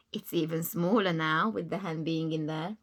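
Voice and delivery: ordinary delivery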